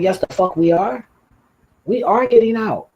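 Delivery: retold yell. Retold insisting on leaving the car.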